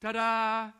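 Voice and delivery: loud, drawn-out showman's ta-da; big hammed-up fanfare; mock ta-da reveal. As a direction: belt it out like a playful grand reveal. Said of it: "but Jesus is going".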